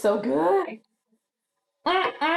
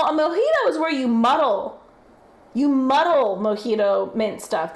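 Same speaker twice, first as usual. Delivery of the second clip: usual speaking voice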